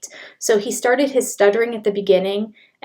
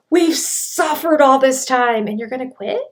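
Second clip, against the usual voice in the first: louder, rising, disbelieving echo; incredulous repeat; 5 semitones higher, 6 dB louder than usual. Retold or imitated. Host echoing Cody's quit line in loud disbelief. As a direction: repeat it like you can't believe he said it. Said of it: "But he's like".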